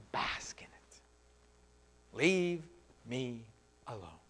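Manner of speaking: whispered, breathless and secretive; defiant whisper. Pretend. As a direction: hush it like savoring a secret sin. Sinner defies God, whispering.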